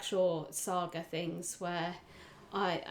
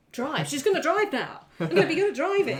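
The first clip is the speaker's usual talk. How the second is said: higher, louder gleeful brag; excited boast; 8 semitones higher, 11 dB louder, faster than usual. Retold or imitated. Player recalling her character's sudden driving skill, gleeful laughing boast. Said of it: "to just be like".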